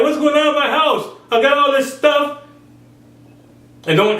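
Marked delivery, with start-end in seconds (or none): 0.0-2.4 high, baffled voice; high puzzled voice of a man who has everything; mock confused man. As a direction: pitch it way up in mock bewilderment. Voicing a confused rich man.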